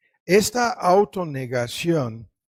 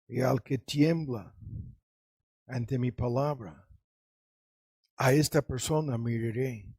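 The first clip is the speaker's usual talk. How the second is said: God speaking softly; 5 semitones lower, 7 dB quieter than usual. Retold or imitated. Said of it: "dice Dios".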